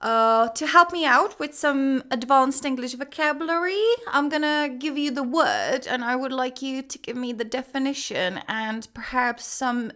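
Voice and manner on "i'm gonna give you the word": normal talking voice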